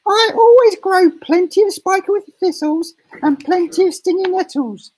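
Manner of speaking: shrill hammed-up hag; nasty old woman. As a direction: screech it out like a mean old witch. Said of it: "she used to say".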